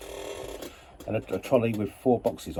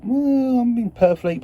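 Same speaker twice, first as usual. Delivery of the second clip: high, loud drawn-out well; musing aloud; 11 semitones higher, 5 dB louder, slower than usual. Retold or imitated. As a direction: act out a bright idea, starting high and loud. Acting out his thought driving home.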